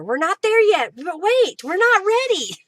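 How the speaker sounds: high, loud, sing-song tease; a playful, loud "not yet"; playful excited teasing. Real, not acted. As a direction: hold the audience back with glee. Teasing viewers waiting for raffle.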